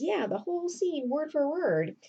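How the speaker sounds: high-pitched retold indignation; emphatic agreement. Lebret confirms the stolen scene, emphatic.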